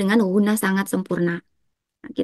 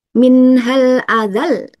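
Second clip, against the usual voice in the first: slow, louder chant with "azal" pressed; stressed final syllable; 3 semitones higher, 5 dB louder, much slower than usual. Shown as a demonstration. Teacher demonstrating naber, slow loud chant stressing "azal". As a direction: recite slowly, driving into the end of "azal". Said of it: "jadi dia dinaberkan".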